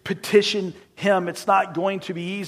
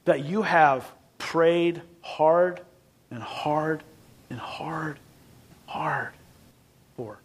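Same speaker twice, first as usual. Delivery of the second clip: fading to a whisper; 3 semitones lower, 4 dB quieter, much slower than usual. Real, not acted. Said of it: "preacher drops to a whisper".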